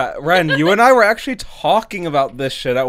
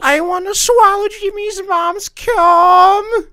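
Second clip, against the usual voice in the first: squeaky joke voice; 4 dB louder, slower than usual. Pretend. High silly put-on voice.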